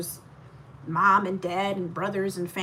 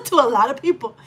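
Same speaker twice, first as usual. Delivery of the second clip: laughing; 7 semitones higher, 9 dB louder than usual. Real, not acted. Laughing at own email.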